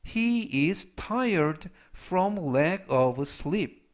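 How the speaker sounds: very slow, word-by-word English model; slow, deliberate teaching read; language-lesson model reading. Shown as a demonstration. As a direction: read it out slowly for learners to repeat.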